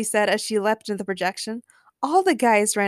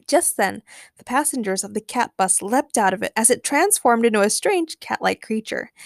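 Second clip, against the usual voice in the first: ordinary delivery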